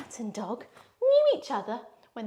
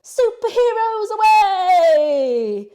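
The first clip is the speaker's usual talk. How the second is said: much higher, louder, slow triumphant call; superhero call; 7 semitones higher, 11 dB louder, much slower than usual. Acted. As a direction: launch them into the sky like a hero. Superhero pose again.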